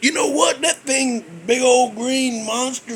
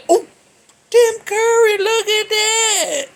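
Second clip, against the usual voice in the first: spotting a funny coincidence, thrilled; surprised; 12 semitones higher, 4 dB louder than usual. Real, not acted. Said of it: "That's coincidence".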